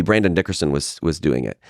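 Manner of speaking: ordinary talk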